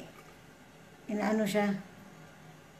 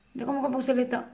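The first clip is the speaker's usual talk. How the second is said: ordinary delivery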